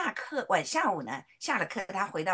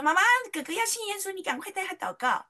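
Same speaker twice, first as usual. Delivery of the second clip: put-on high, louder urgent childlike plea; excited child; 10 semitones higher, 4 dB louder than usual. Daughter urging mom to pray with her brother, high excited voice. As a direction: hurry mom along like an eager kid. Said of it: "那巧巧就赶快说".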